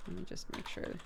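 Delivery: soft muttered check; whispered aside. Real, not acted. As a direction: whisper to yourself.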